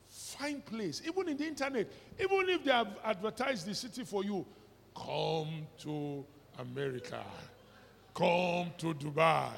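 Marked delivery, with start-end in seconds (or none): 5.0-9.5 mock advert.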